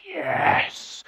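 long breathy, whispered yes; hushed triumph. Acted. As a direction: whisper a drawn-out victory yes. Relieved he finally left.

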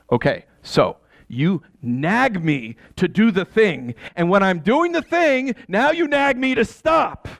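starts slow, then climbs high into a shout; frustrated shout. Acted. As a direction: let the irritation explode at the end. Husband argues with wife.

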